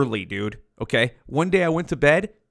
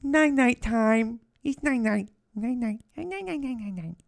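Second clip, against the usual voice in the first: sing-song night-night, fading; 9 semitones higher, 5 dB quieter than usual. Pretend. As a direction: coo it higher and higher while drifting off. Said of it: "I only did that that many times".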